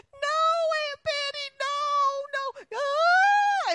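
shrieking, very high and loud; a terrified boy wailing to his aunt; wailing child. Retold or imitated. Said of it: "And he's saying".

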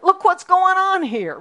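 high, loud mock panic; loud, high complaint to God; mock frantic complaint. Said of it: "we feel like".

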